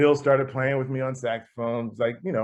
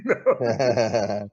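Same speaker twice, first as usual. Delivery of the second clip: ordinary talk, nothing marked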